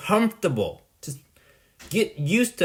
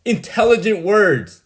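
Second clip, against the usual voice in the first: louder, higher sneering mock of a self-important know-it-all; loud, high mocking voice imitating a pompous friend; mocking Min; 4 semitones higher, 5 dB louder than usual. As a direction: ham up a smug show-off who loves his big words. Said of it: "That's what Min looks like".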